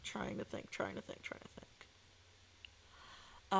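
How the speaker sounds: near-whispered, repetitive muttering; thinking aloud. Real, not acted. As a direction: mutter it under your breath.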